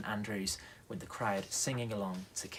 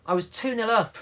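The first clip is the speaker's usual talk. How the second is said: protesting; 9 semitones higher, 7 dB louder than usual. Acted. Jack protests being sent out.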